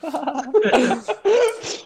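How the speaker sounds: very high, loud laugh; real burst of laughter. Real, not acted. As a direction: crack up at a friend's story. Laughs at friend's punchline.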